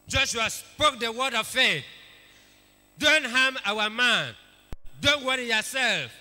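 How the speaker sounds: loud, high, fervent preaching; rally the congregation with Joshua's bold words; preaching at full force. Acted. Preacher shouting Joshua's words of faith to the church.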